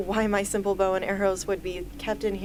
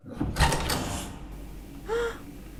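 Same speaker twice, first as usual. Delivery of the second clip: gasp; 14 semitones higher, 5 dB quieter than usual. Acted. Sees wall of weapons.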